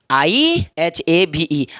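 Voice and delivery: slow dictation of the spelling. Shown as a demonstration. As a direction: spell it letter by letter, slowly. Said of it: "तो I have".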